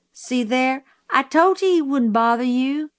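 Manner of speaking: put-on high-pitched childish told-you-so. A narrator's reading. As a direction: prove your point to a friend. Child triumphantly reassures friend.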